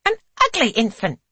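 high, fast cheeky blurt; quick naughty child's jab. A narrator's reading. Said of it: "Kitty adds".

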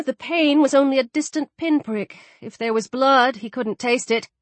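ordinary delivery, nothing marked

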